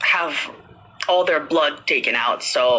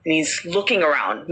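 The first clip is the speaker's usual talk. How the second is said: usual speaking voice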